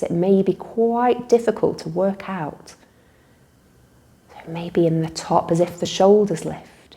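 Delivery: calm guided relaxation with long pauses; guided meditation. Real, not acted. Teacher leading listeners in a body-awareness relaxation, unhurried meditation voice. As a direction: guide them gently through noticing breath and body.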